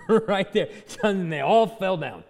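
amused, laughing through it; real laughter. Real, not acted. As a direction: chuckle while telling.

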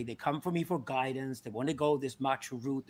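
normal talking voice

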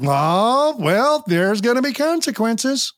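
high, condescending lilt; mock patronizing parent. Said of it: "not patronizing by saying".

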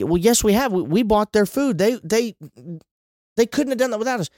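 high-pitched, indignant objector; acted defensive protest. Acts out a listener's defensive objection.

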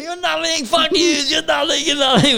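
high-pitched mock heckle; drunken heckling. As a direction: rip into them repeatedly, imitating a drunk. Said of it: "He's fucking ripping into us".

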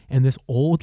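ordinary talk, nothing marked

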